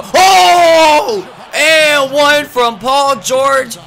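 loud high whoop, then slow; excited shout. Real, not acted. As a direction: celebrate the and-one. And-one play.

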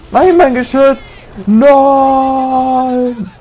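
high drawn-out cry of loss; mourning his destroyed turret in a high wail; anguished cry. Real, not acted. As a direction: wail over the lost turret.

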